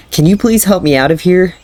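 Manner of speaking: hopeful plea. Acted. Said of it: "he asked, hopefully".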